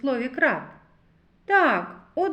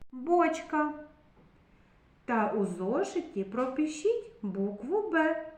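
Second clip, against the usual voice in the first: ordinary delivery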